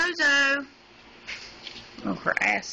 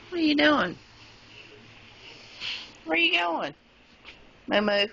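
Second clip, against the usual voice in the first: high sing-song coo at the animals; high cooing pet voice; pet talk; 8 semitones higher than usual. Real, not acted. Coos at the pets.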